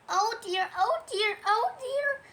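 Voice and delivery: high, small worried voice climbing with each repeat; mounting high-pitched dismay; fretting Piglet. Acted. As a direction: fret in a rising squeak. Frightened Piglet frets to himself.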